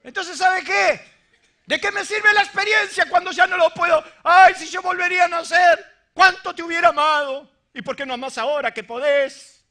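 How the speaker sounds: shouted, impassioned sermon cry; fiery preaching. Acted. Pastor pleading with the congregation, shouting. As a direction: preach it out full force to the crowd.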